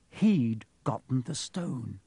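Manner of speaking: usual speaking voice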